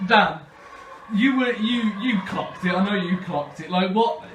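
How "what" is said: usual speaking voice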